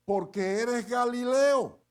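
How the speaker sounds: loud, high and slow accusation; accusing retort. Retold or imitated. Bystander accusing Pedro of being Galilean, loud and slow. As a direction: call him out as a Galilean. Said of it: "le dijo".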